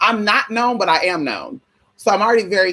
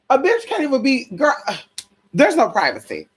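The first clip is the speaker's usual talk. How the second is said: exasperated rant pitched way up; high rant; 6 semitones higher, slower than usual. Retold or imitated. Telling her friend there's no privacy, high exasperated rant. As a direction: complain with rising disbelief, high.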